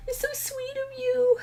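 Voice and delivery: high, touched voice on the edge of tears; overwhelmed by kindness, almost crying; close to tears. Real, not acted. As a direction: voice rising high as tears threaten. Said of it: "I'm gonna cry".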